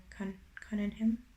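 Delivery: ordinary delivery, nothing marked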